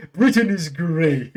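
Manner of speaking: slow, loud laughing "Britain is great"; mock the patriotic slogan through laughter; a hammed-up proclamation, laughing. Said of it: "and saying".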